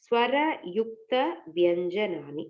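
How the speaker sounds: ஸ்வர, யுக்த, வியஞ்சனானி said as separate chunks with pauses; syllable by syllable. Shown as a demonstration. Teacher showing students the term, syllable by syllable. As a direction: break ஸ்வர யுக்த வியஞ்சனானி into clear pieces. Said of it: "இப்ப இதுதான் வந்து".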